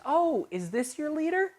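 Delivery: very high-pitched imitated question; imitated hotel manager. Manager asking lost student about his leader, high imitated voice.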